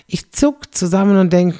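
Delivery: usual speaking voice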